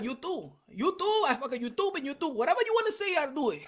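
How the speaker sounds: brash Dominican alter ego calling out Jake Paul, laughing through it; Dominican accent. Acted. Calling out Jake Paul as Papi Shampoo, loud Dominican accent. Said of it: "my Dominican accent".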